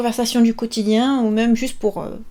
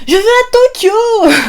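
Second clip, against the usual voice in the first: excited exclamation; 11 semitones higher, 8 dB louder, slower than usual. Acted. Dramatic sample answer in lesson.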